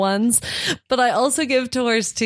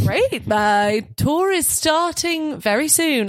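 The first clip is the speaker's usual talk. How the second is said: higher, drawn-out British tour-guide voice; British accent; 6 semitones higher, slower than usual. Retold or imitated. Guide greeting visiting English schoolboys, British accent. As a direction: announce it slowly in a put-on British accent. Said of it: "I get to use my British accent".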